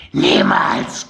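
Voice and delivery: sudden shriek. A narrator's reading. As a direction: spit it out as a raw attack cry. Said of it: "kreischte sie unvermittelt".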